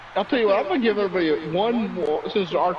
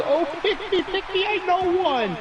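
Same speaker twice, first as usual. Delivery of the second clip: put-on much higher whiny kid voice; eager begging child; 13 semitones higher than usual. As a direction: plead to be picked like a bursting kid. Johnny begging again.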